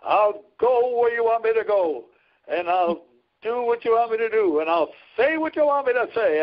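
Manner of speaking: pitched well up, preaching it with fervor; imitating Billy Graham. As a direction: quote the famous evangelist from the pulpit, high and ringing. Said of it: "I heard a sermon of his, and, and he said".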